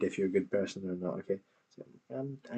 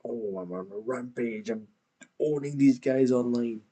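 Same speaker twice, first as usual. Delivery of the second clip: mocking the creator's bravado; 2 semitones higher, 4 dB louder than usual. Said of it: "If you go like".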